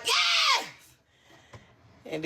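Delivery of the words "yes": high, squealed yes; excited yes. Retold or imitated. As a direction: squeal it like your crush picked you. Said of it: "I was like".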